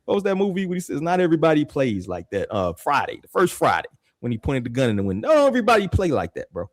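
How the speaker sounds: ordinary talk, nothing marked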